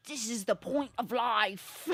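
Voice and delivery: put-on slow, drawn-out British accent; Russell Brand accent. Doing a movie character at dinner, hammed-up British accent. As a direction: put on your best British rom-com rogue accent. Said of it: "That's my best accent".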